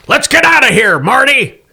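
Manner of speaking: high, loud panicked shout. Acted. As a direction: yell to flee. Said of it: "One-Eyed Matt shouts".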